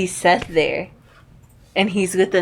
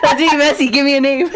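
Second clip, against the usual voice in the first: giggling through the words; laughing at the gossip; 6 semitones higher than usual. Real, not acted. Laughing while talking.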